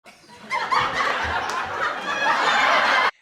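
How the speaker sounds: breathy burst of high laughter. Real, not acted. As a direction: laugh out loud, amused. Laughs at the internet's take.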